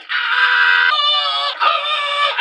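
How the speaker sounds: very high cartoon scream; mock scream. Boss losing it over deletions, mock screaming. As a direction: shriek twice like a cartoon.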